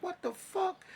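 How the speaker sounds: a squeaky, incredulous outburst; disbelieving what the fuck. Retold or imitated. Seeing false records on the computer, a high incredulous squeak. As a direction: pitch jumps way up in shocked disbelief.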